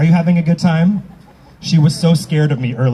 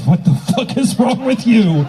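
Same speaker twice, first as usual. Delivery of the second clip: laughing through the question; cracking up mid-line; 5 semitones higher than usual. Real, not acted. Host laughing at a newbie in the crowd, cracking up.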